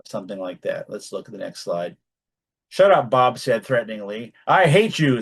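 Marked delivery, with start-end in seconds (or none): 2.7-3.1 sharp, loud snapped order; angry. Shown as a demonstration. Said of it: "we don't like these". 4.5-5.2 angry. Shown as a demonstration. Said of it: "said Bob tearfully".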